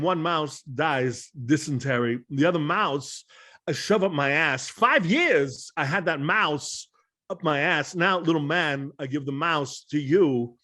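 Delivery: slow, halting actor impression with odd punches; put-on New York movie-star cadence, slowly stretched; Christopher Walken. Shown as a demonstration. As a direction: mimic a dramatic monologue, pausing mid-thought. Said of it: "played by Christopher Walken".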